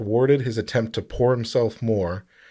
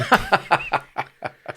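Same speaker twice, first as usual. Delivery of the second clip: high breathy burst of laughter; laughing; 7 semitones higher than usual. Real, not acted. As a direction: crack up mid-story. Laughing at the airplane story.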